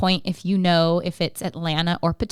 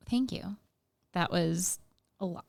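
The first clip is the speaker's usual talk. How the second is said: soft thanks; 10 dB quieter than usual. Real, not acted. Overwhelmed host after long answer.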